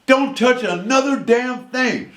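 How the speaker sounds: high, slowed, aggrieved scolding; imitating an outraged elderly wife; furious old woman. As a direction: accuse them in a high old-lady voice, rising to a warning. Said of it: "This old lady screwed up".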